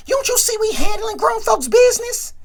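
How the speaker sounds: put-on high, loud put-down of a kid; mock scolding. Smokey brushing off little Megan, high and loud. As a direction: talk down to her, voice jumping up high.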